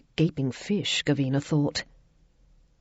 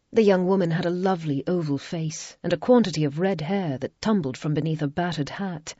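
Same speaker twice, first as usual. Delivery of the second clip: ordinary delivery, nothing marked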